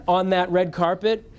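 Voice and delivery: normal talking voice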